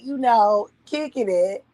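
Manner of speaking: normal talking voice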